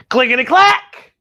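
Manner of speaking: high, loud playful clatter; clickety-clack bone sound. Pretend. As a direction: bones rattling, sung out. Sound effect of bones.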